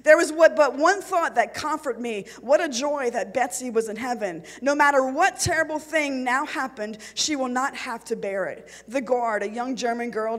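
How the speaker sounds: ordinary talk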